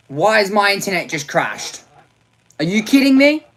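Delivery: loud, high disbelief; exasperated outburst. Real, not acted. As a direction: blurt it out in shock. Internet crashes during live stream.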